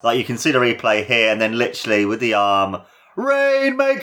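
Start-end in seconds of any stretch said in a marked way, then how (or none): 3.1-4.0 high excited shout of the move; name it with a burst. Real, not acted. Excited naming of the move in replay.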